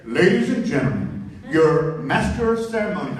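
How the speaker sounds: deep, loud announcer imitation; mock event announcer, pitched well down; voice of God. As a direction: boom it out low over the hall. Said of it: "They call it the voice of God".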